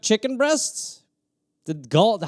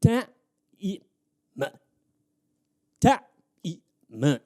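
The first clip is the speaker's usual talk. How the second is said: clipped syllables. Shown as a demonstration. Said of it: "time is money".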